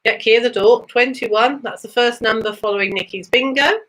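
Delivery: ordinary talk, nothing marked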